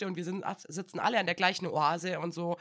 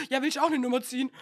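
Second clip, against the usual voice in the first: eager colleague; 5 semitones higher, 6 dB louder, faster than usual. Retold or imitated. Colleague asks, retold brightly.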